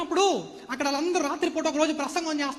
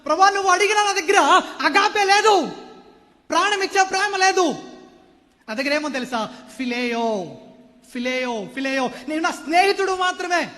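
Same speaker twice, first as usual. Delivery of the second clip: Peter confessing; 4 semitones higher, 7 dB louder than usual. Acted. Peter's confession, loudly acted.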